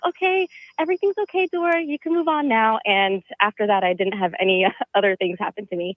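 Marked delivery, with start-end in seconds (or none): 0.1-2.8 higher, fast coaxing voice; gentle talking to a ghost. Retold or imitated. Caller coaxing a ghost to move on, light and high. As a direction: soothe the little ghost along. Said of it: "And I was like".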